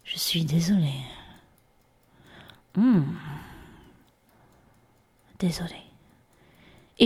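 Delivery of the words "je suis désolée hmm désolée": whispered, lower apology; meek whisper. Pretend. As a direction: defuse them in a whisper. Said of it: "je vais faire".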